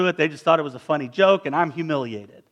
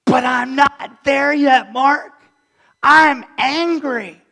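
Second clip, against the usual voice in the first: higher, louder, slow heated protest; angry objector; 6 semitones higher, 4 dB louder, slower than usual. Acted. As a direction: let the hurt listener's anger boil over. Acting an angry unforgiving listener.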